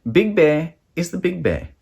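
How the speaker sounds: ordinary delivery, nothing marked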